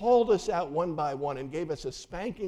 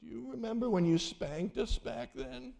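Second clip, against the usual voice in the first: put-on weak little girl voice; 9 semitones higher, 3 dB quieter than usual. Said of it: "she says in a weak voice".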